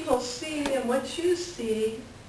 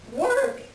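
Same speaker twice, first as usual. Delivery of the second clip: last work jumps much higher; exasperated final work; 12 semitones higher, 3 dB louder than usual. Acted. Wife fed up with husband's endless chores, exasperated. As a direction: fling the third work up in exasperation.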